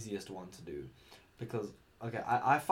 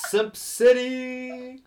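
high, drawn-out mock announcer shout; playful announcement shout; 14 dB louder, much slower than usual. Podcaster launching the simp segment, loud announcement. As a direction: holler it like a big title, stretched out.

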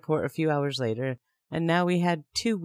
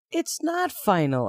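a retold yell, slow and higher; mild mock yell; 6 semitones higher, slower than usual. Woman retelling how she yelled at her boyfriend, mild yell. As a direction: push back hard, half-voiced shout. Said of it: "I yelled".

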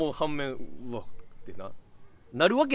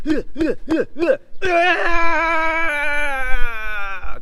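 grunting build into a huge high yell; battle yell; 13 semitones higher, 10 dB louder, much slower than usual. Retold or imitated. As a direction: strain and grunt, then roar it out. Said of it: "気合い入れて、うわーとか言って".

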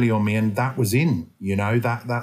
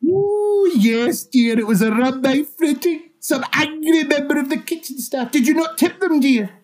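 very high falsetto dame; hammed-up, high-pitched dear old lady; fussy old dame; 4 dB louder than usual. Plays a fussy pantomime-style dame.

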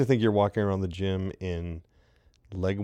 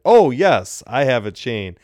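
loud, higher burst of understanding; light up loudly as it clicks; retold enthusiastic exclamation; eager realization; 3 semitones higher, 8 dB louder, slower than usual. Retelling eager answer about chain.

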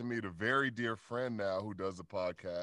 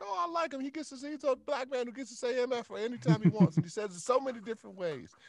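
high, eager mock fan voice; admiring fan; 13 semitones higher, 3 dB louder, faster than usual. Fan gushing about Sam Jackson cussing, high and eager. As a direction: gush like an impressed young fan.

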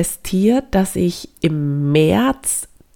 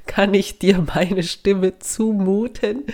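usual speaking voice

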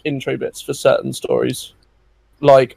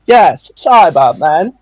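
slow and louder, drawing out "Yes, Cybermen."; a slow, smug Cyberman answer; sassy reply; 2 semitones higher, 7 dB louder, much slower than usual. Retold or imitated. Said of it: "a bit sassy".

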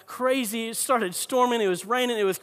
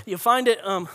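sudden snort while laughing. Real, not acted. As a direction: let a snort slip mid-laugh. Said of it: "did I just snort?".

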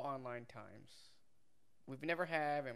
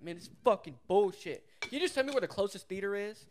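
louder, higher mock outburst; retold rude customer; 6 semitones higher, 8 dB louder than usual. Moviegoer griping at ticket clerk, imitated irritation. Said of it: "he was being a dick".

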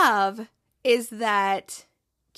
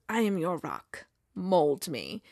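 low, hushed, solemn mock-prophet; grand soft Zava voice; 6 semitones lower, 5 dB quieter, slower than usual. Star player solemnly greeting his new manager, low and hushed. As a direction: intone it like a self-serious guru.

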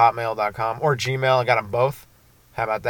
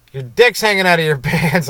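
loud, higher retold punchline; exasperated call-out; 5 semitones higher, 7 dB louder than usual. Cop to an exposed drunk walker, loud exasperated call-out. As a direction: call it out, amused disbelief creeping in.

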